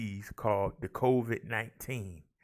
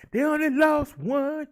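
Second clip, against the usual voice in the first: high, loud triumphant call; showy announcement; 14 semitones higher, 9 dB louder than usual. Acted. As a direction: crow it out like good news. Stat read with showy emphasis.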